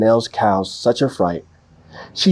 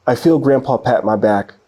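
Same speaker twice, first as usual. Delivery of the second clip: ordinary delivery, nothing marked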